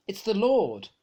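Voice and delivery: excited exclamation. Acted. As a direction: exclaim it as you realize who it is. Disciple recognizes Jesus, exclaims.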